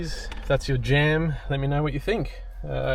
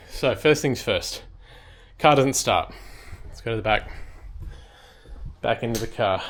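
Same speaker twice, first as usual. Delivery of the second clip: ordinary talk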